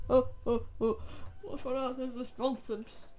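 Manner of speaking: mock despair. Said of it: "I'm in immense physical emotional pain".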